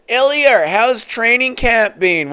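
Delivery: lightly imitating the interviewer; mock reporter question. Streamer mocking a reporter asking Ilya a question.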